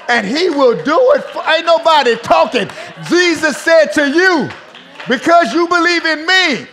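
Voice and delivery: high, loud shouted exhortation; fiery pulpit shouting; full-force preaching. Real, not acted. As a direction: drive each phrase home at a shout.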